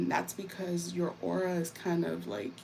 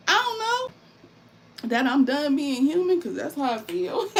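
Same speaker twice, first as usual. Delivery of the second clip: laughing; 8 semitones higher, 7 dB louder than usual. Real, not acted. Joking, then laughing.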